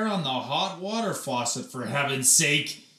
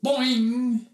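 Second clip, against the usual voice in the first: springy boing; 10 semitones higher, 5 dB louder than usual. Acted. Hair springing sound effect.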